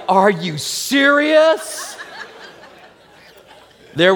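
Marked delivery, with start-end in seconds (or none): 0.1-2.0 mock incredulous wife. Wife's incredulous reply.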